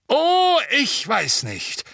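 loud, high booming official; booming woman's voice. Acted. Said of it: "mit ihrer dröhnenden Stimme". (dröhnende Stimme, laut und höher)

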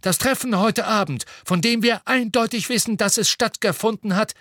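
high, emphatic official voice; resolute Fudge. A narrator's reading. Fudge sets out to settle the matter, resolute. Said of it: "ein für allemal klären möchte".

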